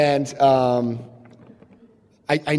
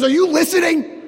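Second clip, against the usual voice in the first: pitched way up, a sister's incredulous snap; high mock retort; 12 semitones higher than usual. Woman snapping at the man asking for forgiveness, high. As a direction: toss it back sharply, high.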